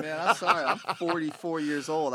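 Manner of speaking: after laughing hard. Real, not acted. Guest laughing after the crash clip.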